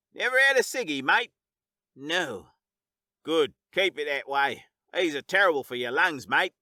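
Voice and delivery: friendly Australian teasing, then warning; Aussie bloke. Acted. Cat's Aussie voice in story.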